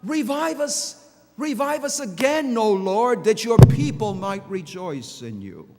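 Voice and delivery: preacher voicing the psalmist's cry to God, loud and pleading. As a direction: call out the prayer with full force. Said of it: "the psalmist cries out".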